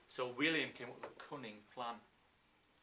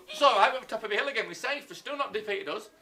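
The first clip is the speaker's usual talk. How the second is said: loud, raised, hurried pretend reassurance; mock relieved boasting; 7 semitones higher, 9 dB louder, faster than usual. Teacher plays the English back on the hilltop, excited mock relief. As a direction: rattle it out to comrades, pitched up. Acting out the English feeling safe again.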